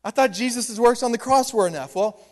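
normal talking voice

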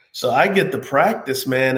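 normal talking voice

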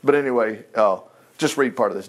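ordinary delivery

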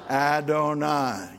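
Adonai repeated slowly; slow demo. Shown as a demonstration. Pastor repeating Adonai for the congregation, slow. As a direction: let Adonai roll out slowly.

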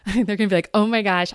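loud, laughing restart. Real, not acted. Host laughing after knocking her mic, loud.

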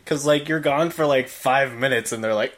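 normal talking voice

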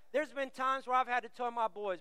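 ordinary talk

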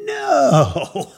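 long high no falling away; drawn-out comic no, breaking into laughter; exasperated no. Acted. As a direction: stretch it out in mock despair. Punchline, no tickets found.

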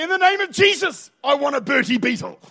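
loud, high proclamation, then the demand; hammed-up declaration of faith; mock preacher's shout. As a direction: playfully bellow it like the boy in the shop. Jokingly re-enacts the boy's shouted demand.

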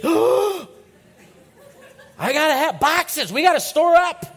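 high, loud, frantic yelp about stocking up; panic-buy like the world is ending; mock hoarding panic. Preacher playing a Twinkie hoarder in a hammed-up, high, loud panic.

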